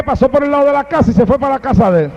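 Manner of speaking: ordinary delivery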